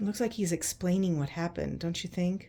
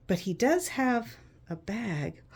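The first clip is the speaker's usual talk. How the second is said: ordinary delivery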